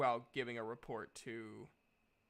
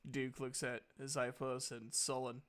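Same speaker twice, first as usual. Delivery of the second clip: ordinary talk, nothing marked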